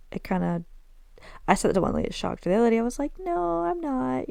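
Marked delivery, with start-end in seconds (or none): 3.2-4.3 mock high denial. Shocked lady's retort imitated.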